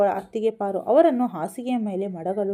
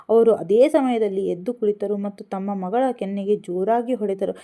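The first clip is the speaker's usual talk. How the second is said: ordinary delivery, nothing marked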